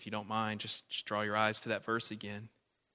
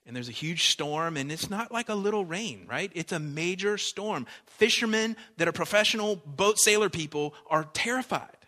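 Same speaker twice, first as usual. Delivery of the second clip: louder, higher storytelling build; rising, loud dramatic telling; building excitement; 6 semitones higher, 9 dB louder than usual. Acted. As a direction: paint the storm bigger and bigger. Storm story builds loudly.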